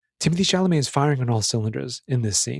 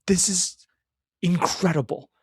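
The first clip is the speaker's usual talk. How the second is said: awestruck; 5 semitones higher, slower than usual. Retold or imitated. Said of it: "I was just like".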